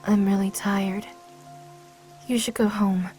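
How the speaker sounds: low, subdued and tired; drained, dejected murmur; weary dismissal. Acted. Weary, sends the visitor away.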